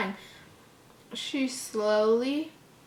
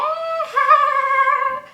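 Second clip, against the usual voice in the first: high, loud maniacal cackle; a wild shrieking giggle; manic laugh; 9 dB louder than usual. Acted. Jeff's crazy smile at mirror.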